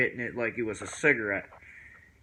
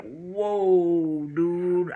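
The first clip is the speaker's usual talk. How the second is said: long drawn-out, higher "whoa"; stoned amazement; 7 semitones higher, 4 dB louder than usual. Retold or imitated. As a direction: act out being blown away, stretched. Acts out being suddenly stoned.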